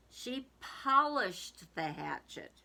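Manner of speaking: ordinary delivery, nothing marked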